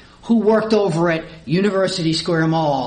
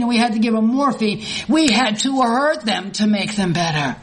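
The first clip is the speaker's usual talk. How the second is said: higher, louder preacher's fervor; fervent preaching; 6 semitones higher, 3 dB louder than usual. Acted. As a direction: preach it with full conviction, slowing on key lines. Preaching at full force.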